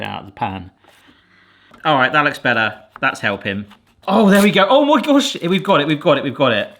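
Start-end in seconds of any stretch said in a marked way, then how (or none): 4.1-4.7 louder, bursting with relief; real delighted triumph; excited relief. Real, not acted. Brownie finally comes out. 4.7-5.4 Oh my gosh lifted higher and louder; real thrilled exclaim; excited. Real, not acted. Brownie finally comes out. 5.4-6.7 louder, bursting with relief; real delighted triumph; excited relief. Real, not acted. Brownie finally comes out.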